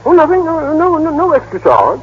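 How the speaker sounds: high, quick protest of no charge; hasty denial. Acted. Slick lawyer hastily assures client there's no fee, pitched high. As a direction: brush the question off too eagerly.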